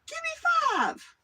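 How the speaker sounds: very high, slow squeaky demand; hired bomber naming his price; mock kid. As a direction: ask for the nickel in a tiny high voice. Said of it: "He'd say".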